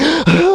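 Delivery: a sudden high panicked gasp; imitated sharp gasp. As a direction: gasp in like a frightened child. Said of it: "like that".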